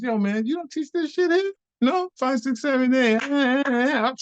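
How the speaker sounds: very high, sing-song challenge; mocking taunt. Taunts teacher while dancing, retold.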